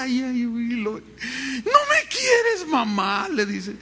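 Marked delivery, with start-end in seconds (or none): 0.0-0.8 high mock child's whine; child whimpering. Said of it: "el niño chilla". 0.9-3.3 very high, drawn-out mock child's sob; crying child. Preacher voices a spanked child.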